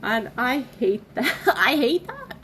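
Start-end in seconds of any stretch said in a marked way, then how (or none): 1.5-2.4 higher and louder, laughing it out; embarrassed laugh while saying it; laughing through tears. Real, not acted. Speaker laughs at herself for crying on camera, laughing. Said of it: "I hate being an emotional person".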